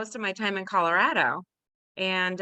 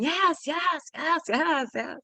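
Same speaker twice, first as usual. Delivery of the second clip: much higher mock "yes, yes, yes"; mock the silly repeated yes, higher; ridiculous repeated yes; 8 semitones higher, slower than usual. Bruce's ridiculous repeated yes, done much higher. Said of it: "you'd sound ridiculous".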